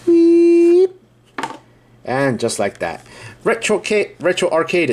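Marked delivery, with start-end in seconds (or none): 0.1-0.9 very high, loud, sustained "weep"; high squeaky pop-out noise. Shown as a demonstration. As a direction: sound effect of something sliding out, high and loud. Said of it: "it pops out like this".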